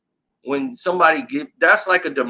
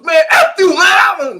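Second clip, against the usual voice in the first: a high, loud yell, cursing out the coach; furious shout; 13 semitones higher, 8 dB louder, slower than usual. Retold or imitated. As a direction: blow up and scream at him.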